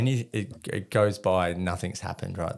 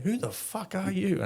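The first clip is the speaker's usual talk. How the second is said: demanding; 11 semitones higher than usual. Retold or imitated. Colonel demanding.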